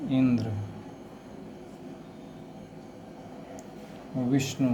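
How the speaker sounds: Indra and Vishnu with weight on the first syllable इं and वि; stress on first syllable. Shown as a demonstration. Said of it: "बल जो है, पहला अक्षर पर ज़्यादा लगता है".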